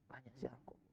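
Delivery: sudden hushed whisper to the congregation. Real, not acted. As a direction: lean in and whisper the advice. Preacher drops to a whisper. (berbisik pelan kepada jamaah)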